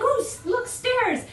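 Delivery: surprised exclamation. Retold or imitated.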